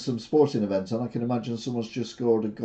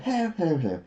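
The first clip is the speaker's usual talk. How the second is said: cheery celebrity greeting; 6 semitones higher, faster than usual. Retold or imitated. Star greeting the crowd.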